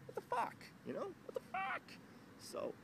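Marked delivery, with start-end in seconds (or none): 0.1-0.5 pitched way up in disbelief; disbelieving what the fuck, pitched high; rising disbelief. Real, not acted. Repeated disbelief building. 1.2-1.8 very high incredulous squeak; squeaky disbelief. Real, not acted. Repeated disbelief building.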